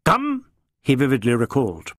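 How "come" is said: high, sharp one-word summons; a curt, high call through the door; sharp command. A narrator's reading. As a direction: snap it out like a stern boss. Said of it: "there came the sharp".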